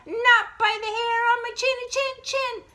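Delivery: very high, loud little pig voice; defiant piggies. Acted. Piggies refuse the wolf, high and loud sing-song. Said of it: "And the piggies say".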